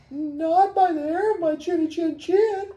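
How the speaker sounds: very high falsetto refusal; squeaky, defiant piglet; little pig voice. Acted. Little pig refusing the wolf at the door, little pig voice.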